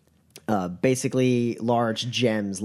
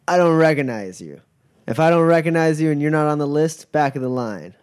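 bouncer voice; 5 semitones higher, 6 dB louder than usual. Acted. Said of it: "he kinda looks you up and down and goes".

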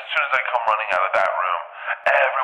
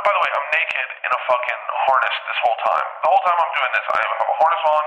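ordinary talk, nothing marked

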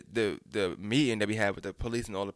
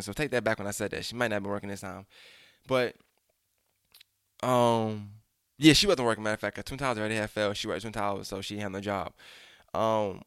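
usual speaking voice

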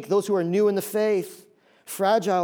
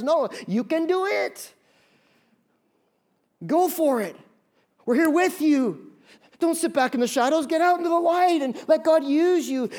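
higher voice urging someone to step out and let God use them; encouraging cheer; 7 semitones higher than usual. Retold or imitated. Encouraging voice acted out.

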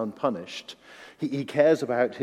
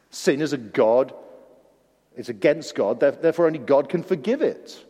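usual speaking voice

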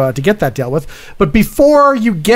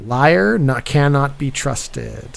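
slow, falling away at the end; dictating notes; 3 semitones lower, 5 dB quieter, much slower than usual. Pretend. Reviewer noting down a verdict on Bert, slow dictation. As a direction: draw it out as if writing it down.

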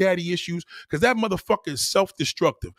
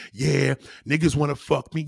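low, smug mimicry of a boast; low mocking quote; mock self-assured woman; 6 semitones lower than usual. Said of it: "you think you got it going on".

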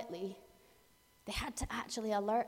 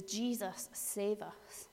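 quiet plea; 7 dB quieter, much slower than usual. Retold or imitated. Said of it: "what we want to scream is".

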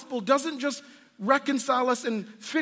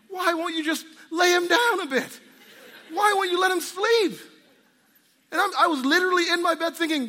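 exasperated complaint; 4 semitones higher than usual. Retold or imitated. Tired father complaining to God about sleepless baby, exasperated. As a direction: whine at God like a parent at 3am.

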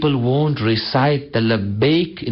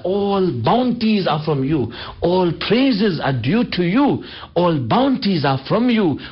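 fervent preaching; 5 semitones higher than usual. Acted.

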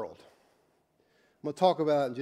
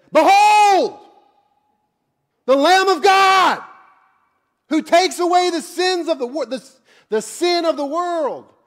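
yell; 14 dB louder than usual. Acted. Preacher voices John announcing Jesus, excited yell. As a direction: cry it out at full volume like a proclamation. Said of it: "I'm gonna yell".

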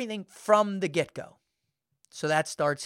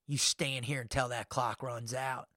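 firm inner voice; 5 semitones lower, 8 dB quieter than usual. Retold or imitated. The calmer part of him telling himself to stay, softer and lower. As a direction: lower and much softer, steady command. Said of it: "another part of me is like".

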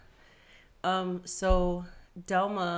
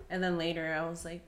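ordinary talk

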